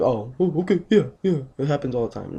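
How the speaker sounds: high-pitched, exaggerated breezy agreement; mock nonchalance. Acting out the sister shrugging off bad news, mock nonchalant. Mocking a nonchalant reaction he rejects.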